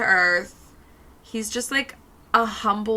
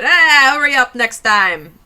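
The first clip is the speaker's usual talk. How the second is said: loud tough-guy scold; mob boss scolding; 3 semitones higher, 10 dB louder than usual. Retold or imitated. Nancy scolding Joffrey after a smack, loud mob boss voice. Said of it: "She smacks him on the back of the head".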